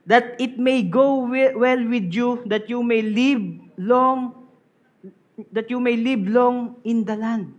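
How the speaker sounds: loud, slow emphatic Bible reading; preacher reading the verse aloud to his congregation; scripture reading. Acted. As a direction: proclaim the verse to the church.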